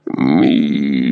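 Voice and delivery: low gurgle, barely audible; gurgling voice. A narrator's reading. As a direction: gurgle the name through blood. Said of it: "пробулькал едва слышный голос". (булькающий, еле слышный голос)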